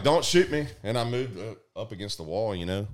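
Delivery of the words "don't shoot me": high, loud panicked "don't shoot"; frightened plea. Retold or imitated. Pressed to the wall facing a shotgun, a loud frightened plea. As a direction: beg not to be shot. Pleading as gun comes out.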